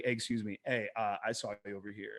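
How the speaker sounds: quiet and easy, no push; low-key, gentle approach; smooth soft opener. Shown as a demonstration. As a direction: say it softly and smoothly, just for her. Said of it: "keep it smooth".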